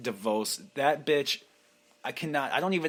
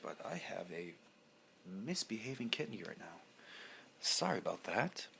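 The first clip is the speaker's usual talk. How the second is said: much softer, muttered aside; quiet apology; 3 semitones lower, 12 dB quieter than usual. Real, not acted. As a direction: drop your voice to apologize. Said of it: "Pardon my French".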